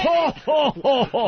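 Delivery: jolly mock Santa ho ho ho; belly-laugh like Santa Claus; hammed-up Santa. Santa bit again.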